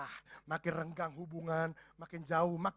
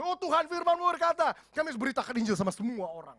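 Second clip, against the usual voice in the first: loud, high, preachy scripture quoting; hammed-up fervent prayer, rising to a loud peak on "membawa pedang"; self-righteous preaching prayer; 7 semitones higher, 8 dB louder than usual. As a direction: proclaim the verses like a zealous Bible student. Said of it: "kutipin ayat jago banget".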